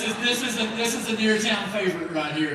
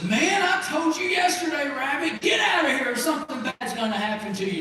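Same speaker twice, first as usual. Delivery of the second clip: higher-pitched, annoyed threat; exasperated farmer warning; 7 semitones higher than usual. Acted. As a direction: scold a pest who came back. Farmer threatens returning rabbit.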